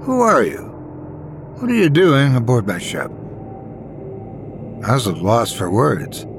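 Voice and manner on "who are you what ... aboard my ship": confused. A narrator's reading. Ghost woman finds a stranger aboard her ship, confused. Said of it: "asked in confusion".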